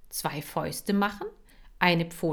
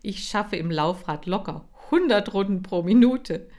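loud laughing boast; laughing brag; 3 semitones higher, 7 dB louder than usual. Acted. Hamster prahlt lachend. (lachend und laut angeberisch)

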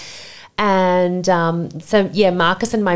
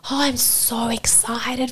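slow, hushed whisper; whispered excitement; 5 semitones higher, 5 dB quieter, slower than usual. Retold or imitated. Friends congratulating her on the baby, slow whispered imitation. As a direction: breathe it out as a secret.

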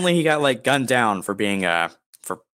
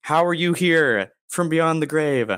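ordinary delivery

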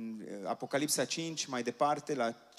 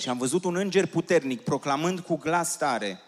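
louder, higher ringing proclamation; preaching scripture; 4 semitones higher, 7 dB louder than usual. Acted. Interpreter proclaiming the verses to the congregation, loud and ringing. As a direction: lift the verses over the crowd.

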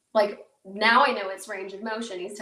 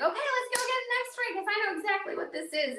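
high, bouncy chipper doctor; mock-cheery doctor, much higher; 13 semitones higher, faster than usual. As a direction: imitate his cheerful upbeat tone, pitched well up. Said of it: "And he's all chipper".

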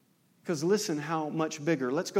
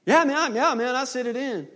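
higher, bluffing younger-brother voice; sheepish excuse; 9 semitones higher, 5 dB louder than usual. Retold or imitated. Younger brother to his older brother, bluffing. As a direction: fake it like you paid on time.

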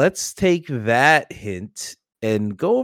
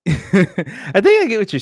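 laughing; 8 semitones higher, 5 dB louder, slower than usual. Real, not acted. Laughing after friend's joke.

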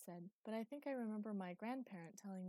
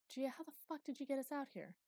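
higher, exasperated outburst at the ship; exasperated demand; 7 semitones higher, 3 dB louder than usual. Acted. Eli demanding to know how Gia got them here, exasperated. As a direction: Eli swears in disbelief at Gia.

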